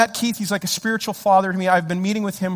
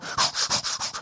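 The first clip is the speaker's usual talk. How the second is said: crazy breathing; 6 dB quieter than usual. Retold or imitated. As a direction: huff and puff in exaggerated calming breaths. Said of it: "we're breathing like crazy".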